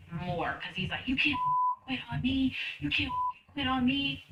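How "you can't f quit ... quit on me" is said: higher imitated angry insistence; mock rage, pleading and furious; a boss raging as retold, a hint of a yell; enraged. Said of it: "enraged him".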